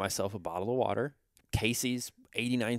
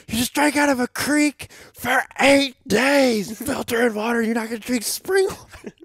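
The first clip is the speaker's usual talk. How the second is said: mock snapping; 12 semitones higher, 9 dB louder than usual. Friend refusing the water he bought, high loud mock yell. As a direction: shriek it at him like he's lost his mind.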